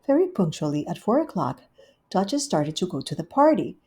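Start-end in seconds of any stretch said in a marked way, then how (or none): none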